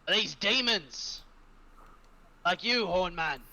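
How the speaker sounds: high, loud in-character demand; Stinksack challenging. Acted. Stinksack points his spear at V, demanding answers loudly. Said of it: "point it at V and go".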